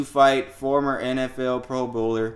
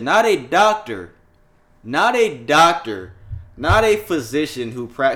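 not a doctor, drawn out slow and louder; slow emphatic stress; 3 semitones higher, 7 dB louder, slower than usual. Real, not acted. Mocking Rodgers for consulting a podcaster, slow emphatic stress. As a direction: hammer each not a doctor slowly. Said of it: "emphatic repetition".

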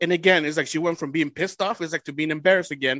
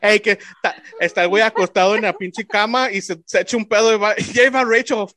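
loud, higher-pitched retelling through laughter; laughing; 5 semitones higher, 7 dB louder than usual. Real, not acted. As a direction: crack up while acting out the joke. Retelling the joke, laughing hard.

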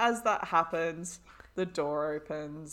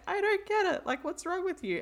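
put-on high girly voice; 11 semitones higher than usual. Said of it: "And she's like".

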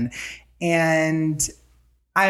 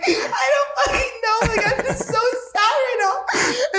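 much higher, slow, fake crying through laughter; mock sobbing wail; slower than usual. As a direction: whine it like a hammed-up sob while laughing. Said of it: "And I start crying more".

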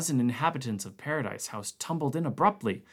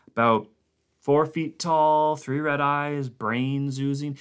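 mock-innocent description; 4 semitones higher, 5 dB louder than usual. Norris mock-describing the creature.

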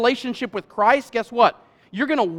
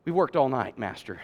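weary mutter; 10 semitones lower, 9 dB quieter than usual. Acted. Simon's exhausted reply acted out.

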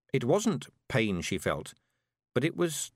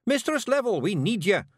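high, urgent Scots call; high-pitched Feegle shouting in her ear; Scottish shout; 11 semitones higher, 4 dB louder than usual. A narrator's reading. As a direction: call urgently to rouse someone. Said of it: "shouted Rob Anybody into her ear".

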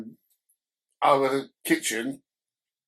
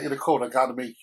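ordinary talk, nothing marked

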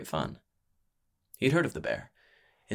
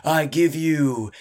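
high, loud, drawn-out build-up; slow showman's sneer; sneering announcement; 7 semitones higher, 6 dB louder, slower than usual. A narrator's reading. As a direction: hold the crowd in suspense before the name. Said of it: "he sneered".

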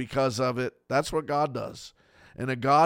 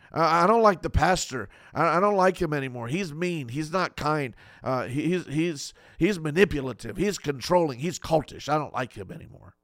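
resentful complaint; 3 semitones higher, 3 dB louder than usual. Retold or imitated. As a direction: list the pastor's faults with a sulky edge. Voicing a believer against pastor.

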